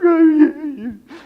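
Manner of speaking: high, loud wail tailing off; hammed-up tearful "yeah" in a squeal; mock blubbering. New convert answering the congregation, mock blubbering. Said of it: "And I'm crying".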